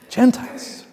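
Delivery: gasped "Gentiles" breathed out high; shocked gasp. Retold or imitated. The Jerusalem church hearing the news, gasping "Gentiles".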